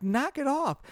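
high mock-bossy toddler scold; toddler telling off. Imitating a jealous toddler scolding her baby sister, high. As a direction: scold like a cross little girl.

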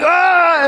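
high, loud startled yelp; startled yell. Retold or imitated. Neighbors startled by the door bursting open, high yelp. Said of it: "they're like".

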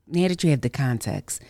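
usual speaking voice

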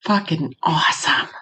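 whispered, deadpan fucking awesome; a whispered ironic cheer at bad news; sarcastic whisper. Real, not acted. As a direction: breathe it out as a dark joke. Dry reaction to relapse news.

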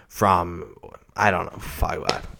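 ordinary talk, nothing marked

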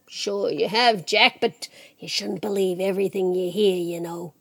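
low, soft, knowing voice; softly dismissive Death; easygoing Death. Acted. Death coolly dismisses Jack's rumours.

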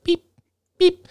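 short, spaced electronic beeps; imitated beeper. Describing the helmet's beeper, imitated beeps. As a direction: beep like a small device.

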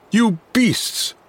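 high, outraged cry. A narrator's reading. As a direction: hurl it at the crowd, voice jumping up. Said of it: "he cried".

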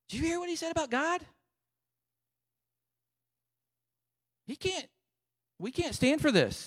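very high-pitched, incredulous protest; appalled disbelief. Acted. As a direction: squeak it out, scandalized. Acted David appalled at silent army.

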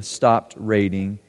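normal talking voice

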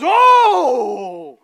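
loud, high crowd roar; crowd gasp. Pretend. Crowd watching the dunk, a loud shouted Oh. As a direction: shout it like the whole gym reacts.

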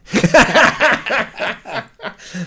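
big high laugh at his own story; a real burst of laughter; hearty laugh. Real, not acted. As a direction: laugh out loud at the memory.

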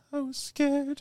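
high, drawn-out scared confession; high mock-fearful voice. Acts out Verbal's frightened answer.

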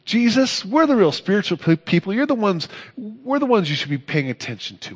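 high, huffy protest; jealous Pharisee. Retold or imitated. Pharisees complaining to Jesus out of jealousy, high and huffy. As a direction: whine that you're the real spiritual people. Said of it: "Were they jealous because".

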